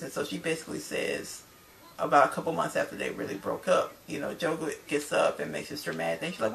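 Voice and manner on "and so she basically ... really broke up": usual speaking voice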